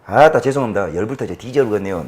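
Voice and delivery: normal talking voice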